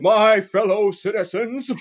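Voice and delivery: high, slow pompous orator, laughing through; grand blustery speech with a laugh; Colonel Starbuckle. Acted. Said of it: "as Colonel Cassius Starbuckle".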